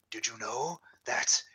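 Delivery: slow, whispery mystery-show host; Jack Palance. Acted. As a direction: draw out a trivial fact like a chilling secret. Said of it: "Jack Palance as the host".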